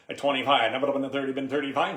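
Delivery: loud auctioneer bid chant. Shown as a demonstration. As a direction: call the bids like a live auction. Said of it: "Give me an example".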